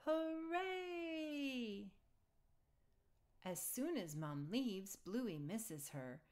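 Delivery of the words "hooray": high, bright shout of joy; excited cheer. A narrator's reading. Kids cheering that Dad is in charge, excited.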